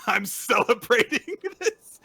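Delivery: loud, high, laughing boast; excited declaration. Retold or imitated. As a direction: crow it through laughter, stretching the end. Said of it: "And Karen's like".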